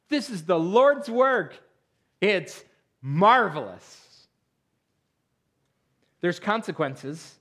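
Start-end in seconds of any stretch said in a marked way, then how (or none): 0.1-4.3 preaching. Acted. Preaching emphasis.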